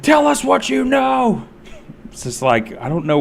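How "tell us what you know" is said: high mock yell of rowdy fans; shrill-pitched heckler impression; imitating screaming fans. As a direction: squeal it like drunk hecklers at David. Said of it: "It's like".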